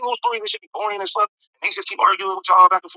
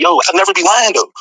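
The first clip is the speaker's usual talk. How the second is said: loud boast; 10 dB louder than usual. Retold or imitated. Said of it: "and I be like".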